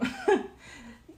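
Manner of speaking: very high giggle fit; high squeaky giggle. Real, not acted. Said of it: "so distracted".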